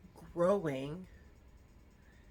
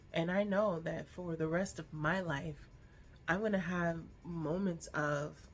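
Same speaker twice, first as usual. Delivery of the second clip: normal talking voice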